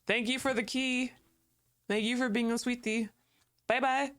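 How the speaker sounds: high, sugary thank-you to a cute character; sweet cooing thanks. Real, not acted. Streamer sweetly thanking Mita for the key, high coo. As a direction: coo at her like a pet.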